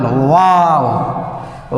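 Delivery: long drawn-out mock wow; mock amazement. Teacher applauds his own drawing for the class, drawn-out mock amazement. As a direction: stretch it slowly in pretend awe.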